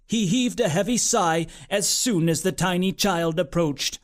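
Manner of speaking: ordinary delivery, nothing marked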